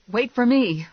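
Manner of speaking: high, loud call after someone. Acted. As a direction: call urgently to a departing friend. Calling after departing Lula.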